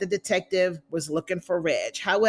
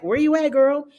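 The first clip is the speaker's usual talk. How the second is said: pitched up and louder, casual call-out; friendly Alicia; 6 semitones higher, 5 dB louder than usual. Retold or imitated. Voicing Alicia texting Candace, high and chummy. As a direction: check in on your girl, upbeat. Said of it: "Alicia's like".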